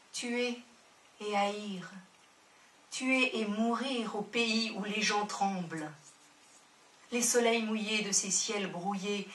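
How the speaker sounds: measured, solemn poetry reading with long pauses; poem recital. Acted. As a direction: let each image land, unhurried. Reads a Baudelaire-style poem aloud.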